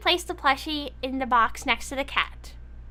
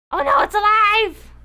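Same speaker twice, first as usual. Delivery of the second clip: mock alarm; 4 semitones higher, 7 dB louder than usual. Toy comes alive.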